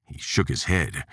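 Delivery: ordinary delivery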